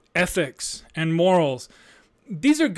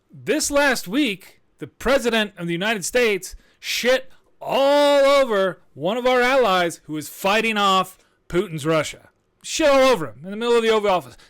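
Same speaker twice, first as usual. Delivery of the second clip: higher, louder, indignant; heated rant; 5 semitones higher, 3 dB louder than usual. Real, not acted. Host ranting to listeners about the president, heated rant. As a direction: build the outrage.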